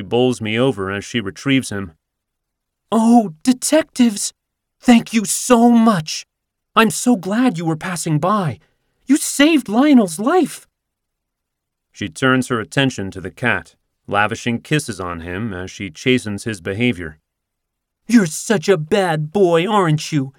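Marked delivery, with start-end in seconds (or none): 2.9-10.7 much higher, slow, effusive thanks; gushing woman. Acted. Grateful owner gushing. 18.1-20.3 put-on baby talk scolding.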